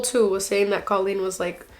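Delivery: ordinary delivery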